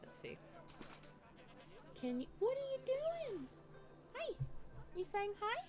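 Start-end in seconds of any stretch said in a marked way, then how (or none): 2.0-5.6 pet talk. Real, not acted. Streamer greeting the pet who came to visit, cooing. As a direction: coo sweetly up high to a pet.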